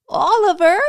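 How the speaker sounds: calling out. Acted. Elf calling for lost Oliver.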